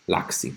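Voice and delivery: "Laxi" said the German way, with an open "ah"; "Laxi" pronounced German. Shown as a demonstration. Streamer repeats a name for the chat, "Laxi" pronounced German. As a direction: say "Laxi" as a German would, quietly. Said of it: "It's pronounced German".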